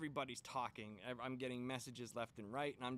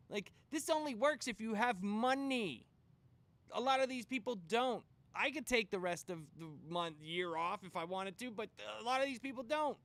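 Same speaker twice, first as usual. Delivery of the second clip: loud, higher heated rant; angry rant; 7 semitones higher, 7 dB louder than usual. Real, not acted. Speaker ranting about the PowerPoint, heated and loud. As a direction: vent it hard.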